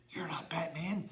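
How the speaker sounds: soft whisper to the audience; hushed stage whisper; whispered aside. Acted. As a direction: whisper it behind his back. Host whispers aside to kids.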